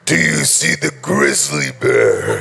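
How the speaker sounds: deep, slow growly bear; low bear voice. Pretend. Joking about looking like bear.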